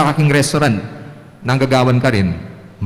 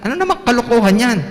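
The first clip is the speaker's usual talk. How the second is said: higher, fast, annoyed teacher; teacher's scolding; 10 semitones higher, faster than usual. Pretend. Teacher scolding Reynold's answer, fast and higher. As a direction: scold the silly answer quickly.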